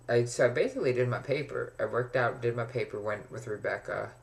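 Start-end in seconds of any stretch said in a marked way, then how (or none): none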